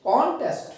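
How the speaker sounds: contest with CON high and drawn out, test falling; stress on first syllable. Shown as a demonstration. Teacher repeating noun examples, contest with first-syllable stress. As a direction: stretch the start of contest, let the end drop. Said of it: "खींच कर बोलेंगे".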